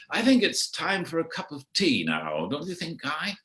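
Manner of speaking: a hint of a languid tea-break plea; gently nudging Guy to stop for tea; [name] wanting tea.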